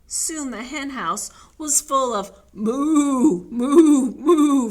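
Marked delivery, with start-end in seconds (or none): 2.5-4.7 loud, high repeated moos; a chorus of loud moos; mooing hens. Acted. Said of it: "from the hens".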